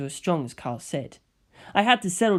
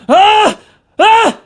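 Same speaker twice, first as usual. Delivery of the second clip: much higher, much louder panicked scream; terrified shriek; 16 dB louder than usual. A narrator's reading. Kira buried under ghostly heads, shrieking in terror. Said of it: "Kira Yoshikage shrieked".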